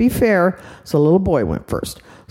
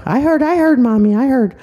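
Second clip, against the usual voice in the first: put-on impatient little girl; 7 semitones higher than usual.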